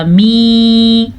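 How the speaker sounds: "می" spelt out and held as mee; corrected vowel. Shown as a demonstration. Teacher spells it for learners, long clear "می". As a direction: lengthen the ee in "می". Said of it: "میم زیر یا".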